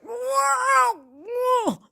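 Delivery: high, loud shocked cry of whoa; stunned whoa. Retold or imitated. Watching the video, she blurts out a loud shocked whoa. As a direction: let the whoa burst out in disbelief. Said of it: "already I'm like".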